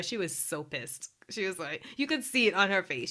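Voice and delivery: usual speaking voice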